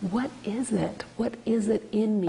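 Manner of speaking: very soft inward wondering; hushed self-questioning. Acted. Student asking what in them attracts bullies, hushed self-questioning. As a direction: ask yourself under your breath.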